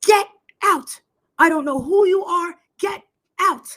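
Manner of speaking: high, loud mock bellow; imitated angry shout. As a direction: yell it as a retold order to leave. Re-enacts man ordering her out.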